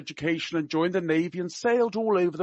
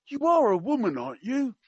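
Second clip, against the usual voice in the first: high mock-Cockney query; attempted Cockney; 7 semitones higher, 3 dB louder than usual. Quoting the prince to a woman with a gift, in attempted Cockney. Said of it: "I can't do Cockney. I will try my best".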